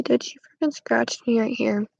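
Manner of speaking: ordinary talk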